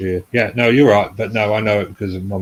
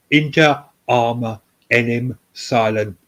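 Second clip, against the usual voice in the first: slow, carefully enunciated Latin; slowly; 2 semitones higher, slower than usual. Shown as a demonstration. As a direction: spell out each Latin word slowly. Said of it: "let me say it again, slowly".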